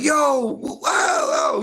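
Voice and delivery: startled yelp. Retold or imitated. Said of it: "I'm like".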